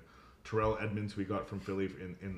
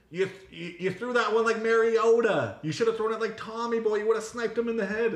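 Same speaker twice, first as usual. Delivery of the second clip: loud, much higher mock Boston heckler; Boston accent; 13 semitones higher, 10 dB louder than usual. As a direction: do a rowdy Boston fan with a thick Boston accent. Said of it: "this big Boston accent guy".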